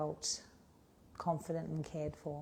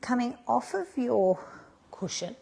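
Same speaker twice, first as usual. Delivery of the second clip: slow, louder, lilting instructor voice; yoga cueing; 3 semitones higher, 4 dB louder than usual. Acted. Yoga teacher cues the class through a shoulder stretch, slow and lilting. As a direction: guide the class through each stretch slowly.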